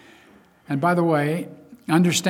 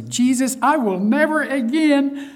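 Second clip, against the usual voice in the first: high, loud, drawn-out vow; naive convert; 12 semitones higher, 6 dB louder, slower than usual. Acted. New convert promising Jesus, naively fervent, high and loud. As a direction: play a naive believer swearing off sin. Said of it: "naively say".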